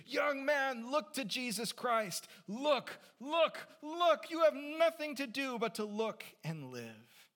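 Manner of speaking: high, loud preacher's shout; impassioned shout. Acted. Methodist preacher to a young man, shouting fervently. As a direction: cry out with all your force from the pulpit. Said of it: "he shouted as only a primitive Methodist could do".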